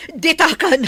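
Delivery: loud, high furious yelling; enraged threat; furious shout. Acted. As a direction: scream the threat at her. Quarrel, threatening to kill.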